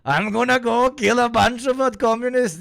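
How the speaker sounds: put-on high-pitched Borat-accented dictator rant; Borat Hitler. Streamer clowning as a dictator threatening communists, Borat voice. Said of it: "I do Borat Hitler".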